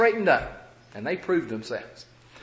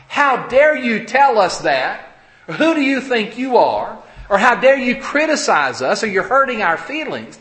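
offended, loud protest with raised pitch; indignant retort; 5 semitones higher, 5 dB louder than usual. Acted. Congregation answering criticism of their sins, indignant. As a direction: push back as if insulted.

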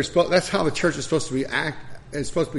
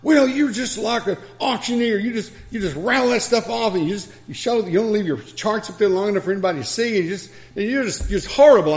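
imitating an angry old man; 7 semitones higher than usual. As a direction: mimic the furious old preacher's rant. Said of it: "he started shouting".

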